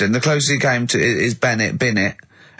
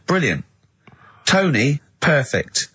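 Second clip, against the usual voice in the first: usual speaking voice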